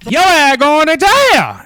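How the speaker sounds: loud, high, drawn-out taunt; stretched-out mocking shout; jeering holler. Real, not acted. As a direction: holler it long and high, gloating at him. Commentator jeers at the arrested man.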